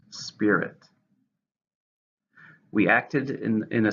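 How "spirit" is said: "Spirit" said SPI-rit, stress on SPI; stress on first syllable. Shown as a demonstration. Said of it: "stress on the first".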